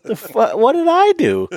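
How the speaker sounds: high, hammed-up bewildered outcry; mock indignation.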